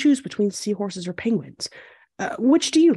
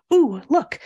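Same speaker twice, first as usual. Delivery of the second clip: usual speaking voice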